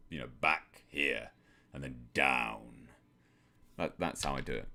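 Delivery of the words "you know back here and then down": voice dropped down. Shown as a demonstration. Demonstrating for a viewer, slow sinking low voice. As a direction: lower and slow, sinking at the end. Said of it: "So right to the back".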